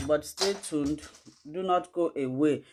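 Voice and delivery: usual speaking voice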